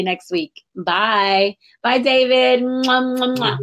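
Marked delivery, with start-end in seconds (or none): 2.6-3.6 smacking kisses goodbye; air kisses. Real, not acted.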